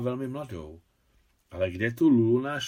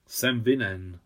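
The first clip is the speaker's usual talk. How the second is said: plain reading voice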